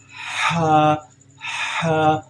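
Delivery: Ha breathed from the middle of the throat; Arabic letter Ha. Shown as a demonstration. Teacher showing students the throat letter: breathy Ha. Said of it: "and say".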